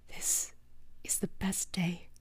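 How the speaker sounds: breathless, hushed whisper; awed whisper. A narrator's reading. Starstruck Steve whispering to his hero Starscream. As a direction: whisper it like a dream come true. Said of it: "he whispered in awe".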